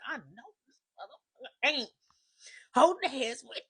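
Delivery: can't believe what she's seeing; woman watching her man with another woman, stunned; stunned disbelief. Retold or imitated.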